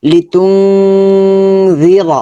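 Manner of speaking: তুংজি chanted slowly with long nasal hum; Quran recitation. Shown as a demonstration. Teacher demonstrating the heavy র, slow chant. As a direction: stretch তুংজি র in measured tajweed. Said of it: "তারপর হচ্ছে র".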